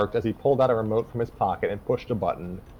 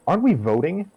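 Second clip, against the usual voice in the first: surprised question; 8 semitones higher, 4 dB louder than usual. Acted. Fran asks, surprised.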